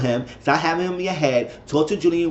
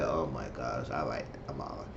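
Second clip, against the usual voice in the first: low, much softer sigh of disbelief; exasperated mutter; 13 semitones lower, 16 dB quieter than usual. Retold or imitated. Narrator muttering at the screen while Amara sits silent, low and exasperated. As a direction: mutter it under your breath, fed up. Exasperated aside during meeting recap.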